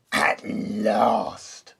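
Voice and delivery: low, menacing wolf growl; a villain's snarl of triumph. A narrator's reading. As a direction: bite the words out like a hungry wolf. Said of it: "snarled the wolf".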